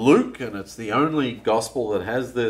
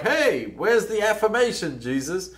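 indignant complaint; 6 semitones higher than usual. Pretend. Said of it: "the tone of voice is important here".

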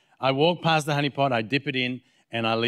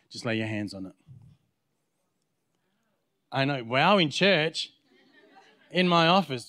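usual speaking voice

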